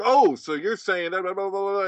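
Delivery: high, sing-song mockery; high mocking voice of a strawmanning listener; mocking paraphrase. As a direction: twist their words back at them. Mimicking someone twisting words.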